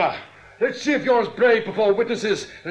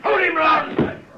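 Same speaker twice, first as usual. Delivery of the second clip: urgent shouted order; 4 semitones higher, slower than usual. Acted. Orders to seize a man.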